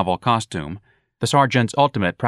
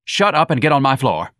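loud, sharp command; angry order; 6 semitones higher, 5 dB louder than usual. Acted. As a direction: bark it to shut him up. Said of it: "Lana said to Jones".

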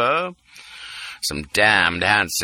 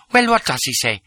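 impatient demand; 3 semitones higher than usual. A narrator's reading. Lola impatient.

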